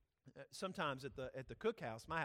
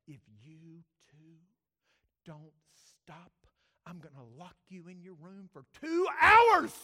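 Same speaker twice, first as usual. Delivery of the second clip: slow, hushed threat exploding into a shout; Laura yelling; 20 dB louder, slower than usual. Retold or imitated. Mother threatening her two boys, building to a yell. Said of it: "now she's yelling".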